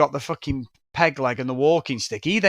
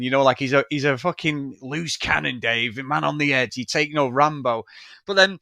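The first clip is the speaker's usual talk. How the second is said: ordinary talk, nothing marked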